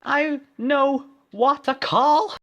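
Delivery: very high, loud, slow; cried. Acted. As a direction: declare it in a squeaky shout. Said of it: "she cried".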